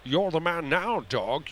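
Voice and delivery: slow put-on accent of a movie mentor; Sean Connery. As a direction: imitate the actor, drawing the words out. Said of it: "Was that Connery?".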